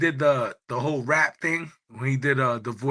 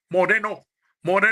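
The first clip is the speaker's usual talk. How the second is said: put-on Panamanian accent; 6 semitones higher, 4 dB louder, faster than usual. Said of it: "like, the Spanish accent".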